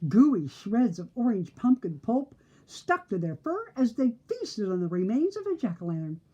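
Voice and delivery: usual speaking voice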